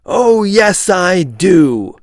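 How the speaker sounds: high, loud defiant shout. A narrator's reading. Hale shouting back at Johnson, loud and high. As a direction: yell it back at him. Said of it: "Hale shouted".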